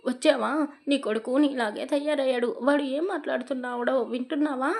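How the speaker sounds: mild tearful plea to her husband; a touch of crying as she complains; wife half-crying about their son; tearful complaint. A narrator's reading. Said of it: "అని ఏడుస్తూ అడిగింది".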